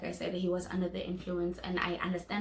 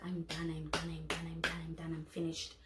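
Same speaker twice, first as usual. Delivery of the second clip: quiet, lower, flatly done; fed-up; 4 semitones lower, 10 dB quieter than usual. Retold or imitated. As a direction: say it softly, finished with him. Breaking up, fed up.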